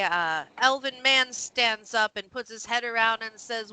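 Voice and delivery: usual speaking voice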